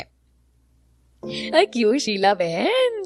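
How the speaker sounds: lightly voiced teasing neighbour; nosy neighbour. Neighbour taunting Sheela from the roof, teasing. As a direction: needle her with mock surprise.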